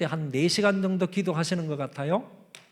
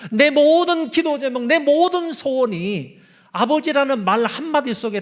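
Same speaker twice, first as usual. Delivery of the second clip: 1 impassioned; 7 semitones higher, 6 dB louder than usual. Retold or imitated. Retold pastor's wife, impassioned.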